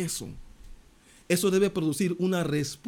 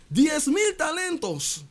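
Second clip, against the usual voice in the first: slow, high stress on mil; emphatic exclamation; 10 semitones higher, slower than usual. Acted. Preacher marvels at the huge debt, slow and high. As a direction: stretch out Diez mil talentos, slow and high.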